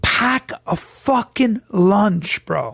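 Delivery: pack a fucking lunch slowly; a slow trash-talk warning to a rival; drawn out threat. Real, not acted. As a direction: stretch every word as a warning.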